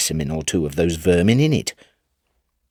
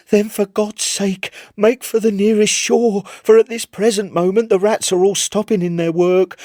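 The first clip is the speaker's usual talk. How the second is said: frantic pleading sailor; 10 semitones higher than usual. Acted. Chips begs the Admiral.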